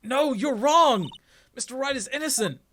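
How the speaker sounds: high, loud woman defending her client; woman's voice. Acted. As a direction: put on a high woman's voice and protest. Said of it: "Woman's voice".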